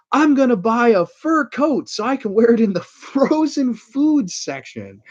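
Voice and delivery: high, loud, giddy wife voice, laughing mid-line, slowing at the end; mock airheaded wife. Wife announcing her lottery fur coat plan, high giddy mock voice. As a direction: ham up a clueless wife's delighted announcement. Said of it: "the wife randomly goes".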